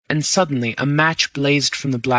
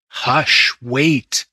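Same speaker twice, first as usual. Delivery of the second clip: ordinary delivery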